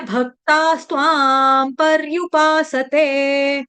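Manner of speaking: slow, drawn-out Sanskrit syllables; broken up slowly. Shown as a demonstration. Teacher modelling for students, slow syllabic recitation. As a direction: stretch each long vowel, piece by piece. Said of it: "तोड़ तोड़ के पढ़ा दिया".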